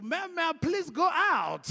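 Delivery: high, loud, slow recited plea; eager kid's request. Retold or imitated. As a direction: recite the only English you know. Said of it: "I was like".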